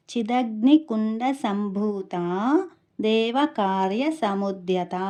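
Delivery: slow, careful chanted recitation of the verse. Shown as a demonstration. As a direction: recite each syllable clearly and slowly. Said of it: "ఎలా ఉచ్చరించాలి".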